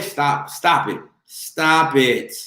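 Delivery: ordinary delivery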